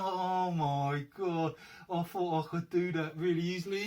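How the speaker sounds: a hammed-up groan of realisation; mock dismay. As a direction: act out mild exasperation at leftover tokens. Acts his own dismay at tokens.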